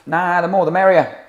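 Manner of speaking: very loud, high yobbish heckle; acted punk yell; punk shout. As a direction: bellow it back at the interviewer.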